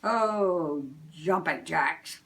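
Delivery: a cheerful Santa oath; Santa exclaiming. Acted. Santa on Christmas Eve, jolly and loud.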